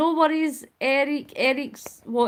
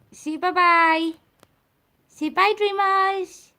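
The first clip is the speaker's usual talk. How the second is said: cooing pet talk; 7 semitones higher, 5 dB louder, slower than usual. Real, not acted. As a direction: coax your pet in a stretched high coo. Prompting the cat to say bye.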